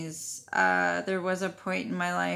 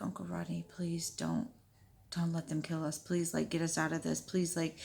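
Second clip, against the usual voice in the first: soft, pleading prayer under the breath; retold prayer; 8 dB quieter than usual. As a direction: plead privately, keeping the volume down. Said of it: "I just kept praying".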